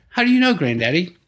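a hint of a sly young granddaughter; granddaughter's voice. Granddaughter catching her granddaddy out, lightly voiced. Granddaughter quoted in a family story.

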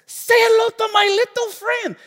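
very high, loud hammed-up Cuban-American accent; bad Cuban-American movie gangster. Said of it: "Well, do it one more time".

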